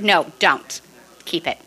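usual speaking voice

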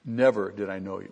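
Never leaned on at the very start; front-load the weight on Never; Never carries the stress, the rest falls; emphasis on Never. Shown as a demonstration. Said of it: "The emphasis in the Greek sentence is always in the first part".

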